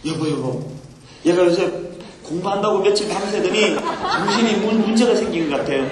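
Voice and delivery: low-pitched worried parent to spouse; worried mother ant. Acted. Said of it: "걱정하는 거예요". (낮은 목소리로 걱정하는 엄마 개미 흉내)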